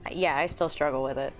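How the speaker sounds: usual speaking voice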